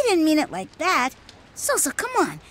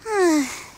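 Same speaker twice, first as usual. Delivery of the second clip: bored sigh. Acted. Bored after a week waiting.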